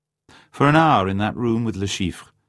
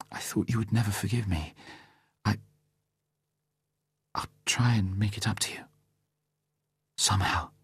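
much softer, grateful whisper through tears; tearful whisper; 3 semitones higher, 7 dB quieter than usual. A narrator's reading. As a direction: whisper it, still teary. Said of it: "gratefully through her tears".

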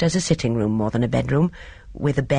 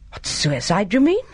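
rising to a much higher pitch; overdone dismay; 6 semitones higher than usual. A narrator's reading. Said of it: "a more than life-size dismay".

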